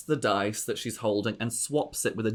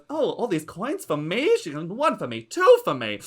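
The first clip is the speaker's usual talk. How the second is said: gloating aunt voice; 7 semitones higher than usual. Acted. Lady Ziza taunting the player at the dice table, high gushing diva. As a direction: coo the taunt sweetly, pitched way up.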